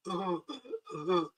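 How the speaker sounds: low, quiet, strange chuckle; creature laugh imitation. Mother imitating the creature's laugh, low and soft. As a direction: mimic the creature's laugh under your breath. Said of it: "that's how they laugh".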